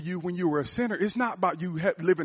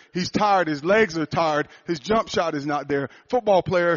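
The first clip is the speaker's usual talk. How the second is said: louder, driving sermon delivery; preaching; 5 dB louder than usual. Acted. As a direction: preach it hard to the congregation. Preaches about being tired.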